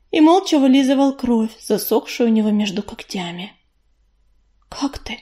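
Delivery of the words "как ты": soft whispered question. A narrator's reading. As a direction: ask it in a hushed whisper. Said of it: "шёпотом спросила Листвичка". (тихим шёпотом)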